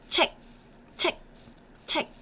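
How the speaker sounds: "check" ends with the tongue closing the throat, no release; stopped final k. Shown as a demonstration. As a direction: say "check" with an unreleased k. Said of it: "you close your throat with your tongue".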